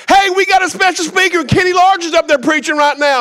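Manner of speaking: high, oblivious shouting down the line; loud phone talker. Retold or imitated. Preacher imitating older man chatting during sermon, shouted. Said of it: "he wasn't quiet about it".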